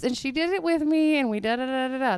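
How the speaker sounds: ordinary delivery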